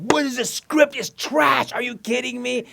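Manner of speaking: high, loud mock yell; mock outrage. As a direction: rant like a furious producer. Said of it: "Now it's like".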